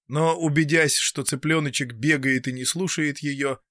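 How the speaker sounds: usual speaking voice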